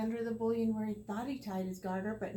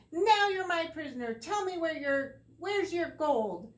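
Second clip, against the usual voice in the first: louder, higher demanding cry; cried out; 7 semitones higher, 8 dB louder than usual. Acted. Tom grabs the leprechaun and cries out loudly. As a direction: seize him and shout your demand. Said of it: "grabbed the sly little fellow and cried".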